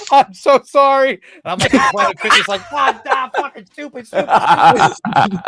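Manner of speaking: half-laughing outburst at his own mistake; self-scolding panic. Retold or imitated. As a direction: kick yourself for blowing the big call. Realizes he hung up on TNA.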